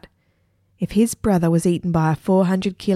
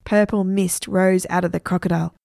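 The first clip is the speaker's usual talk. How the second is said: ordinary delivery, nothing marked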